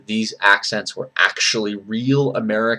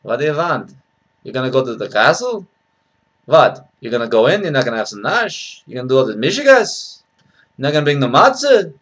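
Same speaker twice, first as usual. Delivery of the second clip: questioning Yiddish-inflected accent. Shown as a demonstration.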